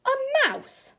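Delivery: high, loud amazed cry; amazed exclaim. Acted. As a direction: squeal it with astonishment. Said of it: "exclaimed the grown-up".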